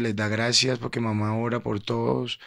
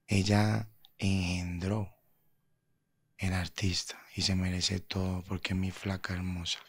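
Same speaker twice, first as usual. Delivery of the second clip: tender whisper; 3 semitones lower, 6 dB quieter than usual. Real, not acted. Son closing the interview with a tender whisper about his mom. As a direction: breathe it out gently for his mother.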